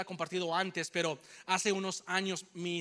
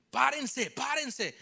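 mock order to stop; 5 semitones higher, 3 dB louder, much slower than usual. Man in truck orders them stop.

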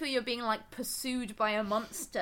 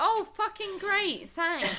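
higher, drawn-out sarcastic thanks; mock gratitude; 7 semitones higher, slower than usual. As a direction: act out an aggrieved, sarcastic viewer. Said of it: "And you're like".

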